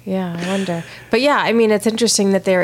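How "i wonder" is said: ordinary delivery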